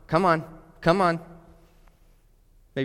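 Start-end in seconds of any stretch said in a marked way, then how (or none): 0.1-1.2 high, loud drill-coach voice pushing him harder; imagined Jesus as a demanding trainer shouting encouragement; pushy coach. Pretend. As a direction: bark it like a coach driving a weak athlete. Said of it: "Maybe some of you think he's gonna say".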